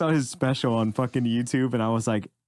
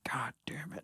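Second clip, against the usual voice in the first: breathy whispered god damn it; jealous whispered curse at a great special; 15 dB quieter than usual. Retold or imitated. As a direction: hiss it under your breath in envy. Envy at the special.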